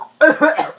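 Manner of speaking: put-on high cough; fake sick cough. Child convincing mama to skip school, fake cough. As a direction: cough pointedly as if ill. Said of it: "Masama po pakiramdam ko".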